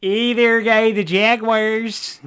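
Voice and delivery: broad Midwest accent, drawn out. Real, not acted. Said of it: "Midwest accent really came out there".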